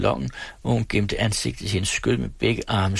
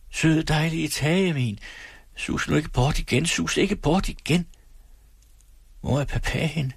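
put-on higher, childlike pleading voice; delirious rambling; 6 semitones higher than usual. Else in delirium pleading like a child inside her head.

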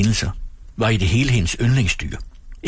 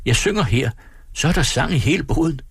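raised-pitch calling, held back in volume; mild narrated call; 7 semitones higher than usual. A narrator's reading. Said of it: "råbte hun". (let tilråb i højt toneleje)

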